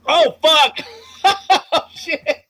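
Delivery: high, loud shocked cry with a laugh; real startled reaction to a big roll; shocked laughing yelp. Real, not acted. GM hearing the nineteen, shocked and laughing.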